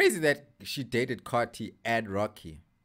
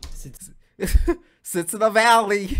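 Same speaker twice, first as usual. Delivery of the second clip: laughing; 6 semitones higher, 6 dB louder than usual. Real, not acted. Streamer laughing at the lyric, repeating it. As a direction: repeat the lyric while cracking up.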